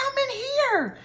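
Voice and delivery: put-on high little-boy voice from far off; pitched-way-up distant child voice; child answering. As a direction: answer mom from inside the toilet. Boy answering from porta potty.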